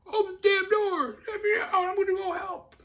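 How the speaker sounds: very high, loud screaming through the door; frantic yelling. Retold or imitated. Mike locked in his room, screaming at his brother, very high and loud. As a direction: pound on the door and yell to be let out.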